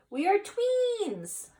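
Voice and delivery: high, drawn-out, sung-out exclamation; delighted announcement. A narrator's reading. Kitty tells the Martian they are twins, delighted announcement. Character announces discovery in story.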